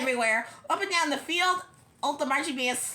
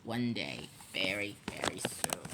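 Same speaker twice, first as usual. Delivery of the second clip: very low, hushed threat; sinister principal plotting quietly; menacing vow; 8 dB quieter than usual. A narrator's reading. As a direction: sink deep and soft into a vow. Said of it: "Mister Krupp vowed".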